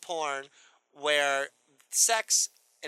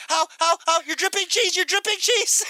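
high squeal, louder, laughing; squealing partner; 12 semitones higher, 6 dB louder than usual. Pretend. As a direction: squeal it in mock shock. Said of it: "She's just like".

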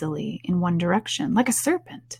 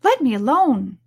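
raised, affronted demand; Alice, indignant; 5 semitones higher, 4 dB louder than usual. A narrator's reading. Alice fending off the beating pigeon, indignant. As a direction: push the pigeon away indignantly. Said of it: "said Alice indignantly".

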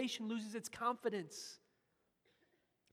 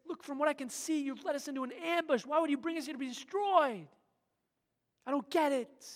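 higher and louder, bewildered lament; honest pleading; 7 semitones higher, 6 dB louder than usual. Acted. Joshua honestly asking God why, bewildered pleading. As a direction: plead openly, not understanding. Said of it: "it's honest".

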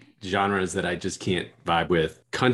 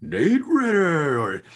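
stadium announcer; 11 semitones higher, 3 dB louder than usual. Retold or imitated. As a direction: call the names out like a stadium announcer. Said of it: "They're like".

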